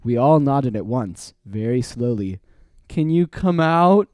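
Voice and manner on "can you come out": slow, high, carefully projected question; raised pitch, deliberate and clear; loud talking-to-aliens voice. A narrator's reading. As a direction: call out slowly as if to an alien. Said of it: "in his loud talking to aliens voice".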